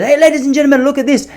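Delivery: high, loud showman call to passers-by; showy market vendor. Retold or imitated. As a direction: ham up the proud stallholder. Market vendor showing off machine.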